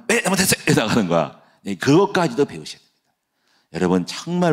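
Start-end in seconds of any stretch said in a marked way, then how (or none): 0.1-0.6 high, snippy imitation of a child; lightly imitated child answering back; mock child reply. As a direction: pitch it up like a sulky kid. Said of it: "이러면은".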